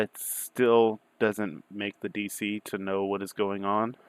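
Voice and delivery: ordinary delivery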